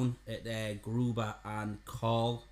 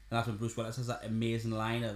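normal talking voice

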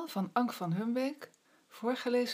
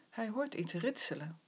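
ordinary delivery

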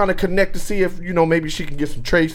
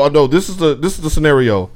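ordinary talk, nothing marked

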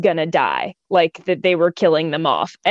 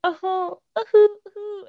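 high, whimpering uh-huh sobs, imitated; mock drunk wailing; 13 semitones higher than usual. Imitating drunk crying to friends, high mock wail. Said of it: "It is full on, like, screaming".

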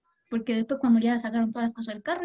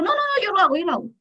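higher insistent customer voice; imitating customer; 7 semitones higher, 4 dB louder, faster than usual. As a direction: mimic the customer brushing her off. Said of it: "Pues me dijo".

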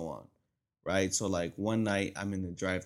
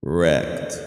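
Wrecked said low and loud; Wrecked, low and loud like a crash; punched Wrecked; 5 semitones lower, 11 dB louder than usual. Shown as a demonstration. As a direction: slam down on Wrecked. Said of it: "echoing the word".

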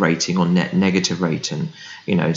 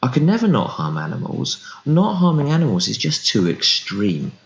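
higher, lightly voiced friend repeating the line; mock friend hearing their own words; slowly realising; 6 semitones higher than usual. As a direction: say it as the penny starts to drop. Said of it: "And they go".

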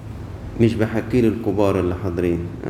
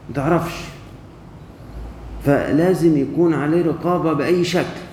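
put-on higher, pressing voice of a young man; insistent young man; 5 semitones higher than usual. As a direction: plead that it's all gone wrong. Quoting the young man's insistence.

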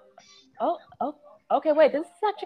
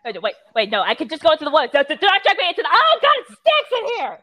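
higher and louder, fast protest; panicked yelling; 4 semitones higher, 5 dB louder, faster than usual. Pretend. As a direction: yell in disgusted panic. Being dragged into bathroom.

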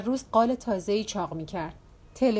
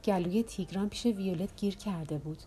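lightly hushed gossip; confiding quietly; 2 semitones lower, 5 dB quieter than usual. A narrator's reading. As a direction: let the secret out in a hushed voice. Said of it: "یواشتر گفت".

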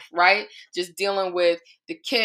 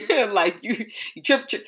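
laughing; 3 semitones higher than usual. Real, not acted. Laughing while teasing God.

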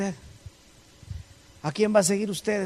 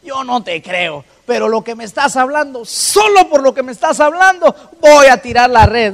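grudging reluctance; 4 semitones higher, 10 dB louder than usual. Acted. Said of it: "de mala gana le dice".

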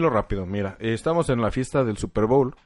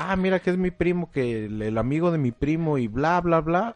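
lightly mocking, higher-pitched introduction; mimicked party guest; 6 semitones higher than usual. Imitating guests who bring a cousin's friend, lightly mocking. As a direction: rattle off a guest's excuse, higher, into blah-blah.

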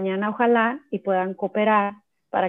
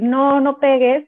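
louder, higher scolding no; mock scolding; 6 semitones higher, 7 dB louder, slower than usual. Mother scolding her child not to hit, louder and higher.

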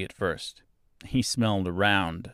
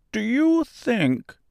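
high, slow, tentative question; wishful straw man; hopeful scarecrow; 4 dB louder, much slower than usual. A narrator's reading. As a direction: ask hopefully in a drawn-out high voice.

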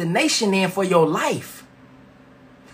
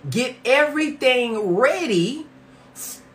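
slow reading; 5 semitones higher, slower than usual. Shown as a demonstration. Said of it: "I'm gonna say that again".

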